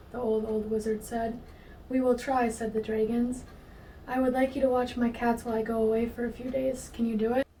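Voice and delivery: ordinary delivery, nothing marked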